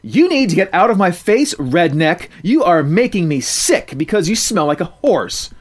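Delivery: loud, high snarl of a bully; spoiled girl threatening. Acted. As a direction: get in her face. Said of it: "Gloria threatened".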